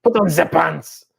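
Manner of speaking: put-on comic German accent order. Dad telling daughter to dress, German accent. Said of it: "I tried German".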